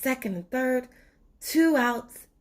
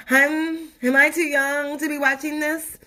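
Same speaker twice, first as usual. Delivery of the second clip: squeamish sister; 6 semitones higher, 5 dB louder than usual. Acted.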